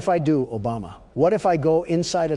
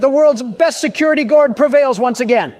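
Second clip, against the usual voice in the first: very high, loud triumphant proclamation; overexcited helium voice; 11 semitones higher, 6 dB louder than usual. Acted. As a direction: crow in victory at an ear-splitting pitch. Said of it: "[name]'s vocals shattered the ozone layer".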